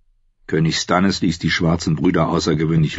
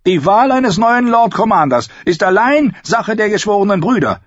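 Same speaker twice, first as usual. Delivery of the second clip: much higher, loud insistence; insistent; 5 dB louder than usual. A narrator's reading. Sir Dennis insists the brothers choose, loud and high. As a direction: stand firm and raise your voice. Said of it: "beharrte Sir Dennis Mallister".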